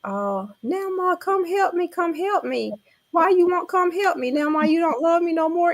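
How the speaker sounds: high-pitched mock wail of a small child; hollering grandchild. Grandmother mimics grandbaby calling for Nana's help, mock child wail. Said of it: "she was screaming and hollering".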